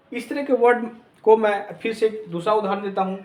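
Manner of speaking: ordinary delivery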